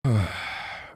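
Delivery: long exhaled sigh; weary sigh. Real, not acted. Streamer worn down by hateful viewers, weary sigh. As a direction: let out a heavy sigh. Exasperated sigh before rant on haters.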